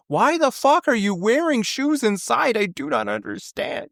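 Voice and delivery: high, slow, disbelieving outburst; high-pitched mock outrage at shoes worn inside; mock outraged Canadian. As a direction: play a baffled Canadian appalled at shoes indoors.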